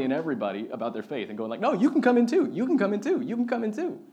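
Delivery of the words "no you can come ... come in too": high, loud, bubbly welcome; eager invitation. Retold or imitated. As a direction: call everyone in with big excitement, pitched up. Christians eagerly inviting everyone in.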